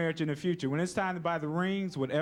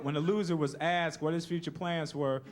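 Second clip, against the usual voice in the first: normal talking voice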